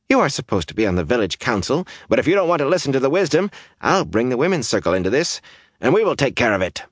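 fast, high-pitched scolding; indignant village woman telling him off; scolding threat. A narrator's reading. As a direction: lay down the law to a councilman. Days threatens the councilman.

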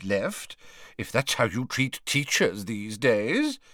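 put-on high, blustering old man; indignant Slughorn. Old man rejects a return to the school, blustering. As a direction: refuse with rising indignation. Slughorn refuses Dumbledore's offer.

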